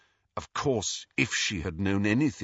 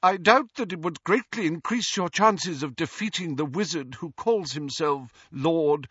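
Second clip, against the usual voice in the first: higher, measured formal doubt; composing herself; 7 semitones higher than usual. A narrator's reading. Ghost doubts Harry's plan, effortfully composed. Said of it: "obvious effort to pull herself together".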